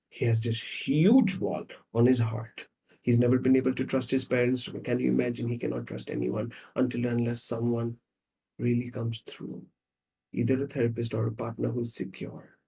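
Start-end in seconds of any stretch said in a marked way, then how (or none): none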